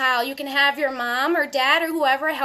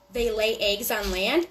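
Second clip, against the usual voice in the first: plain reading voice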